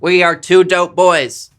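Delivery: JFK impression. Podcast host signing off, hammed-up JFK oratory. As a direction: proclaim it slowly like JFK. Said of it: "I can't do the J F K".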